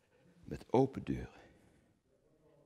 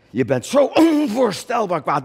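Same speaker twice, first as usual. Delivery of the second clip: angry declaration; 12 semitones higher, 9 dB louder than usual. Acted. Repeats rage line.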